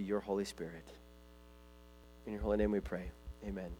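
soft, low prayer voice; hushed closing prayer. Acted. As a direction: pray quietly and earnestly.

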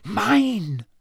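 high, strained, urgent mouthing; mouthing urgently. A narrator's reading. Harry signalling Moody to claim the map, mouthed urgently. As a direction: stretch it out as a desperate silent signal.

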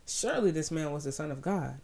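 usual speaking voice

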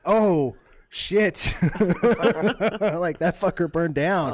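laughing realization. Real, not acted. Man laughing as he realizes the house burned down. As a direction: laugh through the oh shit.

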